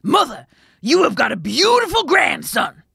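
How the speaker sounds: loud, high announcing shout; miller crying out. A narrator's reading. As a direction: call the news out to mother. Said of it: "the miller ran in and cried".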